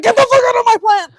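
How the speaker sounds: shouting at the spider; high, loud angry yell; mock yell. Yelling at a spider on her plant. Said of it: "and I'm like".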